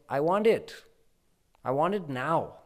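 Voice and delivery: put-on high, loud little-boy demand; child Krishna demanding from the gopis. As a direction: insist like a spoiled toddler.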